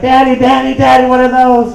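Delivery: put-on high excited kid voice; a little boy excited by deer; mimicking the son. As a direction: squeal it like an amazed little boy. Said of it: "My son's like".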